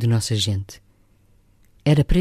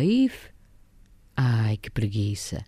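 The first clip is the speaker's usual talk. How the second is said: lazy exclamation; 5 semitones lower, 5 dB quieter, slower than usual. A narrator's reading. Macunaíma as a child refusing to speak, lazy slow sigh. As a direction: sigh it out like a boy too lazy to talk.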